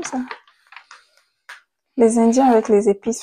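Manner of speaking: usual speaking voice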